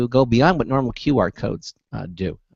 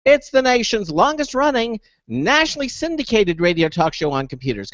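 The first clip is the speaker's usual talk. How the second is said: radio announcer; 10 semitones higher than usual. Acted. Radio show opening.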